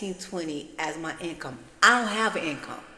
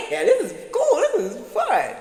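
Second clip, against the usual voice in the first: giggly, high, thrilled; laughing delight; 11 semitones higher, 8 dB louder than usual. Retold or imitated. Telling her ex she's driving Uber, laughing and thrilled. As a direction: bubble over laughing about the new gig.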